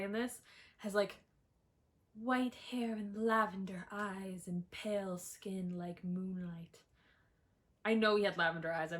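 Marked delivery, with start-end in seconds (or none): 2.2-6.8 mock dreamy. Mock-dreamy book description.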